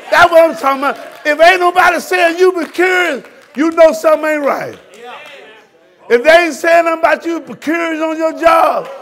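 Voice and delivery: loud, high rebuking sermon; fervent preacher shout; preaching at full force. Real, not acted. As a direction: scold the church with fire. Preacher building again.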